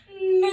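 long, high, loud moo; cow moo. Pretend. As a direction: let out a drawn-out cow's moo. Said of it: "Let that moo out".

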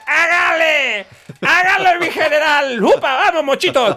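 put-on loud high drunk cheering on horse; drunk shouting. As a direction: yell like a drunk jockey. Drunk riding statue, urging general.

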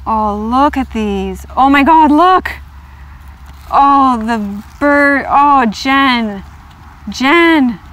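can barely contain the excitement; thrilled exclaiming. Real, not acted. Excited over the deck.

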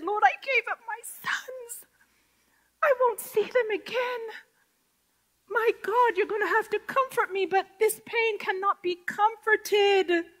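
high voice breaking with tears; crying out. Retold or imitated. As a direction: cry out to the Lord in grief. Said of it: "I cried. I wept".

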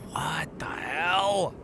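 hushed, breathy disbelief; barely voiced, to yourself; whispered what the hell. Real, not acted. Streamer whispers at strange sight, hushed. Repeated exclamation, first whispered.

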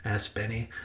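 ordinary delivery, nothing marked

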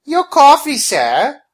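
high, loud waitress voice; brusque waitress call. Acted. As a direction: announce it sharply, startling the customer. Waitress slamming down mug, startling customer.

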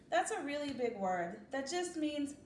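ordinary talk, nothing marked

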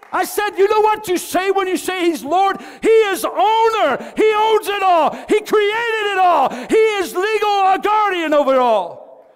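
high, loud, slow preaching shout with a cry; shouting and breaking with fervor; full-force preaching. Acted. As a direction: proclaim it, almost weeping.

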